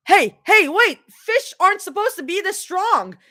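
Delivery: panicked shout. Acted. Rod jerks hard.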